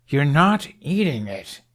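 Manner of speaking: a dragon refusing, voiced slow and odd. A narrator's reading. Toothless answering the dragon, slow and strange.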